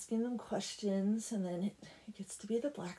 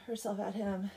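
ordinary delivery

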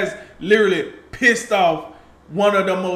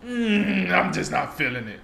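mock indifferent Kyrie; 5 dB quieter than usual. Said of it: "Why not, Kyrie?".

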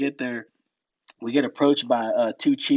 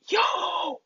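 much higher startled cry; mock shocked yo. Seeing wounded on litters, shocked cry. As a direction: jolt at the sight of the litters.

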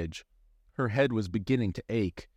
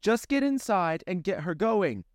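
yelled order; 11 semitones higher than usual. Acted. Amanda yells back at James, high and urgent. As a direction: shout it back. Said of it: "Amanda yelled back".

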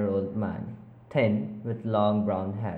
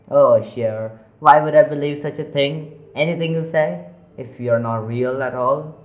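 loud, scornful retort; defiant shout; 4 semitones higher, 10 dB louder than usual. Acted. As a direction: yell back in disbelief. Arguing with voices.

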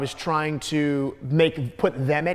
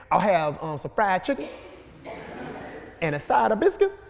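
Southern urban dialect; 2 semitones higher, 4 dB quieter than usual. Shown as a demonstration. Comedian demonstrating the funnier line, Southern urban dialect. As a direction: order it in a Southern dialect, lifting at the end. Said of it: "it's nowhere near as funny as saying".

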